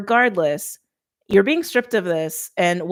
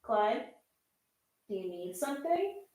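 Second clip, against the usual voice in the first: higher, much softer, away from the mic; gentle pet talk; 6 semitones higher, 15 dB quieter than usual. Real, not acted. Reader checks on needy Clyde mid-reading, gentle pet talk. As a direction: coax a pet softly from across the room.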